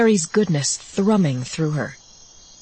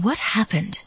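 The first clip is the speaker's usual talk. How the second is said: higher, small frightened question; scared; 4 semitones higher than usual. A narrator's reading. Scared sister asks her changed brother, higher, small voice. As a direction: ask it with understated fear. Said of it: "She is even more scared".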